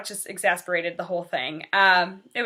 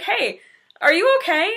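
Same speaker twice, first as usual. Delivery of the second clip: coworker's worried question, pitched up high; high concerned question; 9 semitones higher, 5 dB louder than usual. Retold or imitated. Coworker noticing she is upset, asking gently, high and concerned. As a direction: ask gently but clearly worried, voice lifted. Coworker asks if she's okay.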